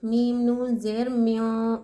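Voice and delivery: slow, with the नून of म्यों hidden in the nose; nasal ikhfa demo. Shown as a demonstration. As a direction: spell out म्यों slowly, nun nasalized. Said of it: "नाक में छुपा रहे हैं।".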